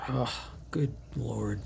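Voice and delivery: soft, slow whispered sigh; exasperated whisper. Real, not acted. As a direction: breathe it out, fed up. Exasperated by hiccups mid-video.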